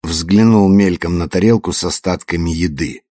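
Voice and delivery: ordinary delivery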